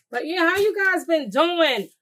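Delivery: high, loud, drawn-out hello to viewers; excited greeting. Real, not acted. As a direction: sing it out slow and bright to the audience. Greeting the viewers mid-cooking.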